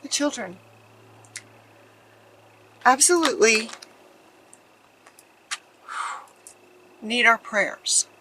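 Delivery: trying not to cry on camera; a voice close to tears, held back; fighting tears. Real, not acted. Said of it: "I don't wanna cry on this video".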